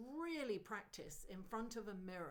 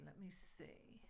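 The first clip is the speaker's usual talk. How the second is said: whispering; 2 semitones lower, 9 dB quieter than usual. Real, not acted. Muttering to herself mid-reading.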